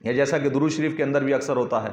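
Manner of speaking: ordinary talk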